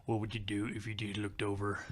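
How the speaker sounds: low, imitated country voice; deep country coworker. Imitating the coworker posing a random scenario, deep country voice. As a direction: pose it deep, in a thick country accent. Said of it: "he had a real deep down low voice".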